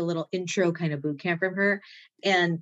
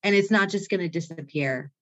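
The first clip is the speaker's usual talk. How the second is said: ordinary talk, nothing marked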